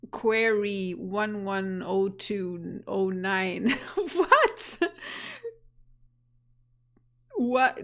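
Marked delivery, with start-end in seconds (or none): 3.8-7.8 laughing, high what; baffled laugh at the screen; surprised laugh. Real, not acted. Game shows odd query text.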